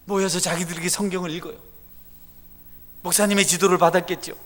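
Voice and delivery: high, loud, fervent sermon; full-force preaching. Acted. Pastor exhorting the congregation, loud fervent preaching. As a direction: preach it out to the rafters.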